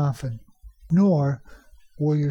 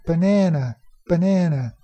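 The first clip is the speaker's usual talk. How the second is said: "banana" with the middle NA longer and louder; stress on second syllable; 3 dB louder than usual. Shown as a demonstration. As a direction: punch the NA in ba-NA-na. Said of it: "higher pitched and even a little bit louder".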